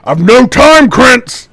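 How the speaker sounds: high, very loud rebuff; furious in-character bellow; angry half-orc shout. Acted. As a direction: roar at an underling who interrupts. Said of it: "he says, uh".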